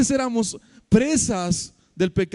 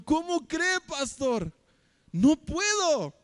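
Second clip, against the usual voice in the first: high, astonished, slowing on no puedo; a young man aghast at the pastor; shocked refusal; 8 semitones higher, 4 dB quieter, much slower than usual. Retold or imitated. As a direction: recoil at the very idea.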